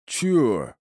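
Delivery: lightly voiced big lunk; mildly booming, puzzled bruiser; booming hulk. A narrator's reading. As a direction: drone it out like a simple strongman. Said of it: "прогудел он".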